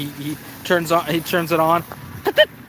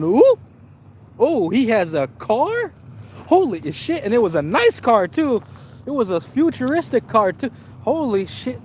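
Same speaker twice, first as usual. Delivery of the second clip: higher, gushing ooh and holy shit; hammed-up gold-digger glee; mock excited gasp; 6 semitones higher than usual. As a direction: act dazzled by the car. Mocking girl's sudden interest.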